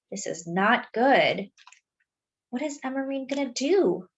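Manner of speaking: ordinary delivery, nothing marked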